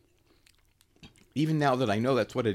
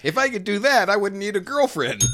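laughing; 9 semitones higher, 5 dB louder than usual. Real, not acted. Joking back, laughing.

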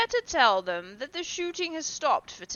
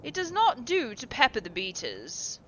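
airy dismissive; 7 semitones higher, 8 dB louder than usual. A narrator's reading. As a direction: wave it off as trifling. Lord Henry dismisses the accident.

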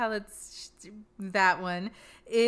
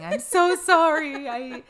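mock tearful apology; 4 semitones higher, 6 dB louder than usual. Podcaster parodies a tearful apology video, hammed-up sobby apology. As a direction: fake a weepy apology video. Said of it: "with tears down my face saying".

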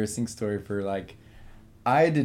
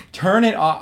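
high, loud mock tantrum; escalated, high-pitched shouted demand; retold scream; 9 semitones higher, 9 dB louder than usual. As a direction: yell the order in a dramatic retelling. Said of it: "I started screaming".

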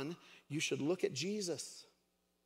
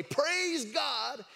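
high-pitched, called-out praise; high mock holler of praise; praise shout; 10 semitones higher, 4 dB louder than usual. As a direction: call it out from the porch like the old lady. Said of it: "hear her come out and say".

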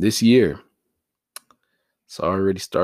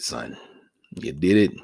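normal talking voice

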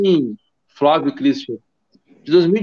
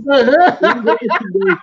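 laughing; 6 semitones higher, slower than usual. Real, not acted. Laughing while mocking the word.

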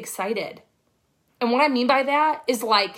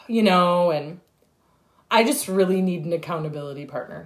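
mock Eeyore; 9 semitones lower than usual. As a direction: whine it like a gloomy donkey. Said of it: "if you are Eeyore".

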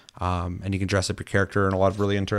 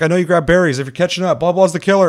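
imitating a high, loud shouted alert; raised mock-panicked call; retold urgent warning; 9 semitones higher, 8 dB louder than usual. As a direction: yell it urgently to someone catching up. Retold player yelling a warning.

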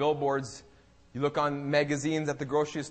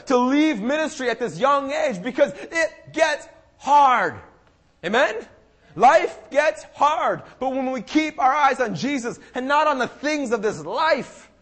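loud, driving sermon delivery; impassioned preaching; 9 semitones higher, 9 dB louder than usual. Real, not acted. Preacher urging the church to keep eyes on Jesus, impassioned. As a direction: preach it urgently, punching key words.

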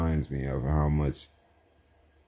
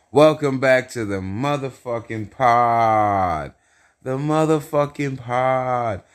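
drawn-out, higher-pitched show opener; hyped podcast intro; 5 semitones higher, 4 dB louder than usual. Acted. Host welcoming listeners back to the pod, hyped announcing. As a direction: welcome them in like a hype host.

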